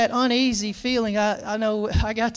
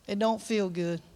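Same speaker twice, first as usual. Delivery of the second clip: ordinary delivery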